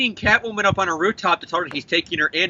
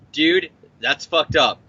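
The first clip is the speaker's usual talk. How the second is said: slow deadpan quote; 4 semitones lower, slower than usual. Retold or imitated. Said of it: "And then Peter's like".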